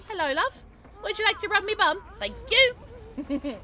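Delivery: high, cheeky British character voice; high-pitched hammed-up British voice; mock British accent. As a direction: put on a silly British accent. Put-on British accent joke.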